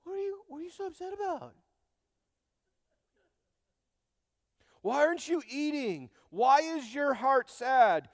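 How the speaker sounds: fast, high, whiny husband badgering her; mock clueless husband. Elkanah questioning weeping Hannah, high and whiny. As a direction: rattle off the dumb questions in a high put-on voice. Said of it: "he follows that up with".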